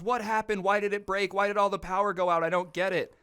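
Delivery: hamming up a clueless, baffled guy; high confused whine. Retold or imitated. Roommate quoted, confused and raging.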